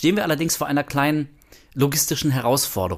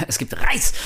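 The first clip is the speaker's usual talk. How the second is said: whispered through a laugh, slower; 8 semitones higher than usual. Acted. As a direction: repeat it giggling under your breath. (lachend geflüstert)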